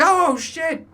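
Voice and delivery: high startled shriek; dad startled at the fridge. Retold or imitated. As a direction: shriek it, caught off guard. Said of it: "I'm like".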